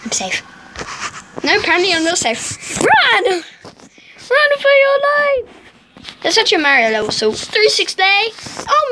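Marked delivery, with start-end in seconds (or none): 2.8-5.5 high, slow panicked cry; dramatic yell. Acted. Fleeing in game.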